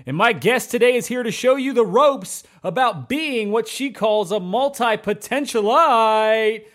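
high, booming hype-man intro over music; grand entrance announcement. Acted. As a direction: announce her like a showman bringing on the star. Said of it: "that was quite an intro".

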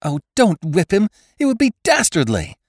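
high, loud, desperate plea; imploring. A narrator's reading. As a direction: beg him with rising panic. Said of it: "implored Jane".

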